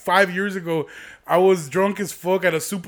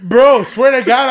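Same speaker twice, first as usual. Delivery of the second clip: loud slow swear-to-God; loud emphatic; 5 semitones higher, 9 dB louder, slower than usual. Real, not acted. Emphatic boast.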